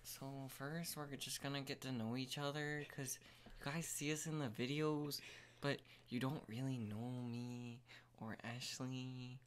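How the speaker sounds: soft, with nose clipped shut. Real, not acted. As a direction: speak through a pinched nose. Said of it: "You got a kitchen clip on your nose".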